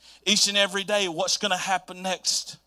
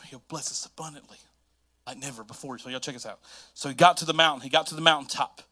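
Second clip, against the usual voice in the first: ordinary delivery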